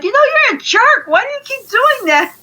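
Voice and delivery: very high, fast nagging complaint; hammed-up high-pitched telling-off; mock scolding. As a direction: scold him in a squeaky, exaggerated rush. Acting out scolding a playful boyfriend.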